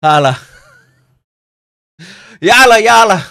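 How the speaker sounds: loud, high celebratory chant; excited cheer. Real, not acted. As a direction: call it out in triumph. Celebrating donations.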